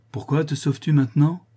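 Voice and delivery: mildly astonished master's query. A narrator's reading. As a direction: ask in feigned innocence, voice lifted. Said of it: "d'un air étonné".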